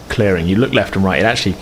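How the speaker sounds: ordinary talk, nothing marked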